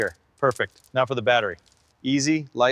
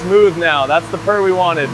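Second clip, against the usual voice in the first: raised over engine; 2 semitones higher, 7 dB louder than usual. Real, not acted. Calling over running engine.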